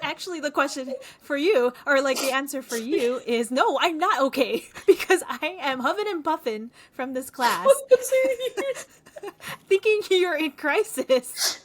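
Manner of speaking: real laughter while speaking; laughing. Real, not acted. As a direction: laugh your way through it. Cohost laughing while retelling.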